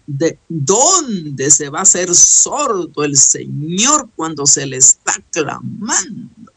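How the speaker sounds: ordinary talk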